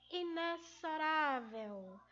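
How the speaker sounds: slow inexorável, x said as z; correct pronunciation. Shown as a demonstration. Teacher introducing the word to pupils, careful correct inexorável with z. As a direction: stretch out inexorável with a z sound for the x. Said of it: "a maneira correta de se pronunciar".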